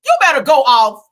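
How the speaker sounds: loud cheering call; rowdy shout of approval; hype shout. Real, not acted. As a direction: shout her on like a fan. Hyping up the speaker on screen.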